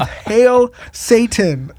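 high, very drawn-out mimicked war cry; mock heckler's taunt. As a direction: echo the heckler's cry, amused and stretched out. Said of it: "who opened with".

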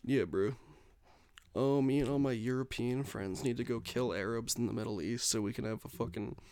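ordinary delivery

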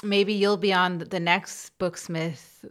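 ordinary talk

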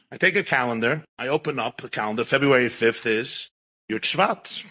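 usual speaking voice

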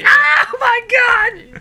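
very high, drawn-out wail; hammed-up loud shriek of shock; mock horror scream. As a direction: scream it like a prank victim. Victim reacts to ketchup attack.

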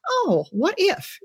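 high, slow wondering; drawn-out "oh" of sudden inspiration; dawning idea. Retold or imitated. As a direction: let an idea dawn on you, slowly. Voices an idea popping up.

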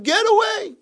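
put-on enraged old man yell; screaming. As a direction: shriek at him to go. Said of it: "screaming at him".

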